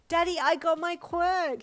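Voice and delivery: put-on high, loud, thrilled child's shout; enthusiastic cry. A narrator's reading. Boy shouts his news into the speakerphone, thrilled. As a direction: yell it into the phone with joy. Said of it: "Hyoko cried enthusiastically".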